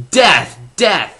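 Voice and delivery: loud, high, punched words; dramatic doom. Retold or imitated. Vlogger contrasting the gory episode, loud dramatic doom. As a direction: hammer each word with mock horror.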